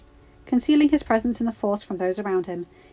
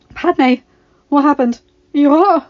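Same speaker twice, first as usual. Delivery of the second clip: alarmed; 7 semitones higher, 12 dB louder, slower than usual. A narrator's reading. Husband finds bleeding wife.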